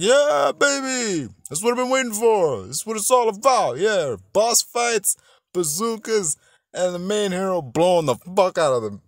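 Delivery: loud, high, exultant cheering; hyped fan cheer. Retold or imitated. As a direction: whoop it up like a thrilled fan. Acts out his excited fan reaction.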